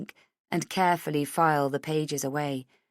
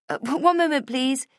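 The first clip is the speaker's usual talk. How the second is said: higher, flustered and hurried; flustered woman; 9 semitones higher, 4 dB louder than usual. A narrator's reading. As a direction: caught off guard by noisy kids. Heather flustered by kids.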